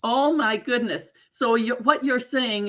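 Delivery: normal talking voice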